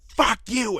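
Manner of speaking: high-pitched mock yell; retold angry yell. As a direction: imitate her parting outburst. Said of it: "and she was screaming".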